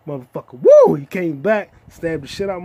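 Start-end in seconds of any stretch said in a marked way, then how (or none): 0.6-1.0 high loud whoop; whooping cheer. Real, not acted. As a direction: let out a wild fan cheer.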